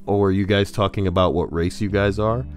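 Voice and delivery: ordinary talk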